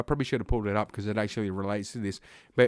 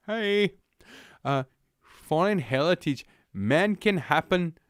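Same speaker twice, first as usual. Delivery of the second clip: higher mock Finnish accent, hammed-up; 6 semitones higher, slower than usual. Mocking the commissioner's words, higher hammed-up Finnish accent. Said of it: "I have no idea what the Finnish accent is like".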